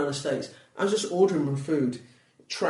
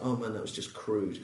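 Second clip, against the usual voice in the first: retold thought, lower and softer; quiet self-reproach; 5 semitones lower, 8 dB quieter than usual. Comic recalls judging own set, quiet self-reproach. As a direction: say it low, as if to yourself.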